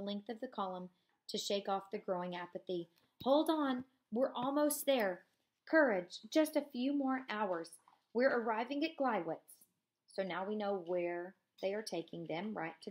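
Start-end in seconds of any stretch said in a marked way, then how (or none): 3.2-5.2 urging officer. Acted. 5.7-7.7 louder, slower, higher call of encouragement; urging call. Acted. SS officer urging prisoners, loud and slow. 8.1-9.6 urging officer. Acted.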